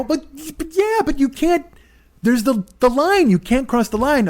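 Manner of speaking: high, stammering objection; acting out a flustered passenger; flustered protest. Acts out protest to the driver.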